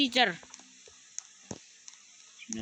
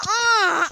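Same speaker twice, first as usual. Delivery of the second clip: high, loud startled scream; real gamer scream; sharp scream; 11 semitones higher, 8 dB louder than usual. Real, not acted. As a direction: let out a sudden cry. Gamer screams after being hit.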